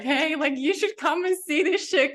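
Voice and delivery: high-pitched eager call without real volume; mock excited scream. Wanting everyone to know what is possible, mock excited scream. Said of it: "scream at everyone".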